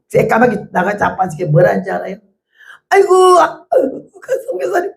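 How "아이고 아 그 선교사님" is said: high, slow, wailing imitation of a tearful woman; wailing woman imitated. The wife on the phone to the missionary, a high, slow mock wail. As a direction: stretch out 아이고 like a weeping caller.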